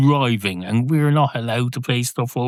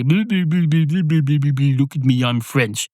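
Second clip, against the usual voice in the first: mock French accent; 3 semitones higher than usual. Teacher showing off his French, mock French accent. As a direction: lightly imitate a show-off's French accent. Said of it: "he would speak in a French accent".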